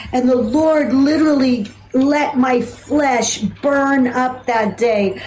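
usual speaking voice

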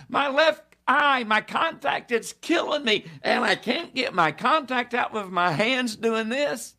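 high-pitched tearful complaint; imitated crying. Preacher acts out John's hurting eye, mock tearful wail. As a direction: whine it out as if still bawling. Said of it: "I've been crying so hard".

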